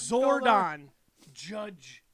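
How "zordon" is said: loud call of a name. Acted. Calling out.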